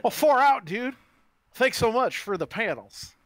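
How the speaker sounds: high, slow Southern surfer drawl; laid-back Southern surfer dude, thrilled; Southern surfer voice, excited. Acted. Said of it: "like a Southern surfer".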